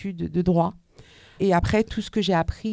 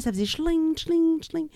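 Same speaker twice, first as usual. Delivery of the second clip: high, bright mouth-made jingle of coins; imitated coin jingle; 9 semitones higher, slower than usual. Telling the class about a friend's jingling pockets, imitated coin sound.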